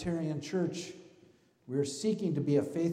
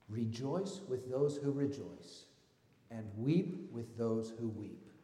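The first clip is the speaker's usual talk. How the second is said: slow, weighty reading of the verse; slow scripture reading; 3 semitones lower, slower than usual. Shown as a demonstration. Pastor reads to the congregation, slow and deliberate. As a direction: let each word land slowly. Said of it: "Listen up".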